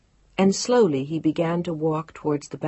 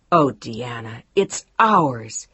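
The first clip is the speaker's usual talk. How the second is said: triumphant; 5 semitones lower, 4 dB louder, much slower than usual. A narrator's reading. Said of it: "triumphantly with laughter and tears".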